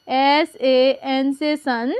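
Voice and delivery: slow letter by letter; patient teacher spelling. Shown as a demonstration. Teacher slowly spells a name for pupils. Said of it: "तो देखिए".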